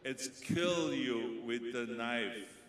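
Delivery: slow, loud "kill"; stressed kill. Shown as a demonstration. Director demonstrates the right line, slow and loud. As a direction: hit "kill", "knife" as nife. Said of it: "This is".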